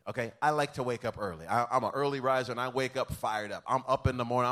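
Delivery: usual speaking voice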